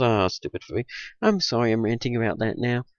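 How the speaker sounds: ordinary talk, nothing marked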